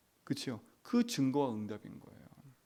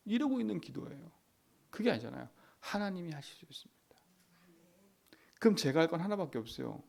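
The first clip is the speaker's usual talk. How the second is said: ordinary talk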